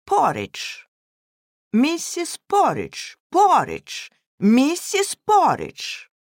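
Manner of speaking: high, drawn-out taunting call; acted children's jeering; children's teasing cry. A narrator's reading. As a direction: call out the nickname like mischievous kids. Children teasing the governess by nickname.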